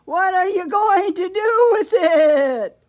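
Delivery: very loud, high, wailing bleat; bleating sheep. A narrator's reading. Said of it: "she bleated".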